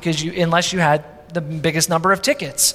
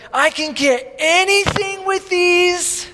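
put-on excited boy; 10 semitones higher, slower than usual. Boy asking about prizes.

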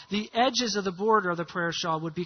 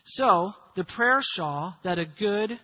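ordinary talk, nothing marked

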